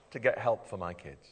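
ordinary delivery

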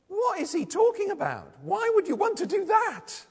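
high, loud, baffled questions; incredulous. Acted. A reader reacting to Thomas's offer to die, incredulous. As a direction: protest in exaggerated disbelief.

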